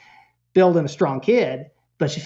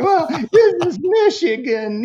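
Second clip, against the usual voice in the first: very high wailed protest; mock a child's tearful objection; whining kid; 12 semitones higher, 4 dB louder than usual. Son objects to going coatless, whining.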